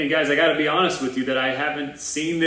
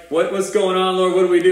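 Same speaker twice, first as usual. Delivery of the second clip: freaked-out plea; 5 semitones higher, 6 dB louder than usual. Retold or imitated. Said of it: "We're like".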